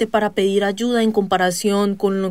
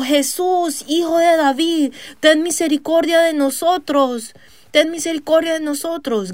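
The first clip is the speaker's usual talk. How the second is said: high, desperate cry for mercy; pleading shout; 6 semitones higher than usual. Retold or imitated. Blind beggars at the gate crying out to Jesus, high pleading shout. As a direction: beg at the top of your voice for him to hear. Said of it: "gritaron diciendo".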